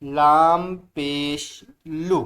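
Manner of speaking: slow, drawn-out letter drill; slow recitation. Shown as a demonstration. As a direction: spell it out slowly for beginners. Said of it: "बुलंद आवाज़ से".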